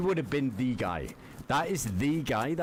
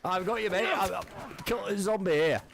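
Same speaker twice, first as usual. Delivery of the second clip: put-on higher, hurried British lad accent; excited fake British accent; 6 semitones higher than usual. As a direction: play the British bloke rushing to help. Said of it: "I'm fucking talking like him".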